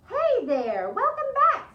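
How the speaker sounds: high, loud, bright greeting; cheerful welcome. Acted. Lisa's mom welcoming them back, excited call.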